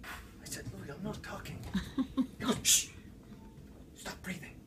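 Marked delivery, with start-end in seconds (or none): 0.8-1.6 hushed protest. Retold or imitated. Protesting while being hushed. 2.6-2.9 sharp "shh"; hushing. Retold or imitated. Hunter silences his chatty partner, hushing. 3.9-4.6 very soft whisper; hushed order. Retold or imitated. Hunter orders his partner to be silent, whispering.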